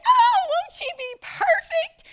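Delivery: high, sugary mock delight; fake-sweet coworker. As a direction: gush over her with fake sweetness. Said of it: "she's like".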